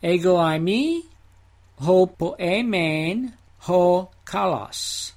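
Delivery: slow, deliberate Greek reading of "Ego eimi, ho poemen, ho kalos"; Greek phrase read out. Shown as a demonstration. Teacher reading the Greek text slowly to his class. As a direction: drawl out "ho poemen, ho kalos" word by word. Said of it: "I am the good shepherd" has the definite article distributed".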